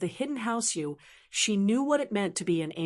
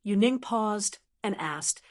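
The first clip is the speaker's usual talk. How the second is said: plain reading voice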